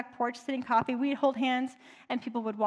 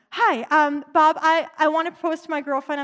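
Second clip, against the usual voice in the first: put-on louder, eager young suitor asking a favour; eager Ryan; 3 semitones higher, 6 dB louder than usual. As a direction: burst out with a hopeful request. Eager young man voiced in story.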